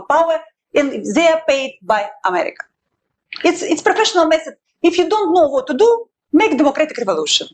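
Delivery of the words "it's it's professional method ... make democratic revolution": fast, high, scornful sarcasm; mock it as a cheap recipe; pitched-up sneering irony; sarcastic mockery. Mocking the American playbook.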